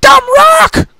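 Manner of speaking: loud, very high outburst; high-pitched furious yell; frustrated shout. Acted. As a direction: shout it like a tantrum. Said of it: "she shouted in frustration".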